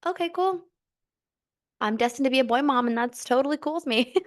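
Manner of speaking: high, quick breezy acceptance; high, fast mock-casual self. Telling husband, breezy and high. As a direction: shrug it off brightly. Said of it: "And I was like".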